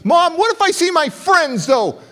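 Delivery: high, loud pleading whine; embarrassed kid pleading with mom; whiny teen plea. Retold or imitated.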